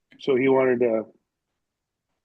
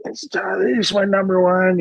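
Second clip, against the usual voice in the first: lightly voiced wife, pitched up; a hint of the wife's higher voice; imitated wife; 7 semitones higher, 3 dB louder than usual. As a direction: retell it as the wife, higher than your own voice. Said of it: "My wife's like".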